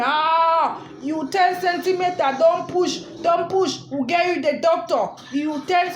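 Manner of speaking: alarmed nurse calling out, mock urgent; retold nurse screaming. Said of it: "The lady was screaming".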